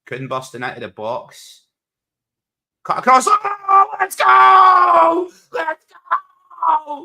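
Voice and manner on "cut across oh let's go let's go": much higher, loud yell of joy; excited shout. Real, not acted. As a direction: scream it as the goal goes in. Goal chance, shouting at screen.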